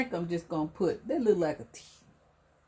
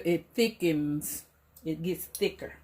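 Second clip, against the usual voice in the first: ordinary talk, nothing marked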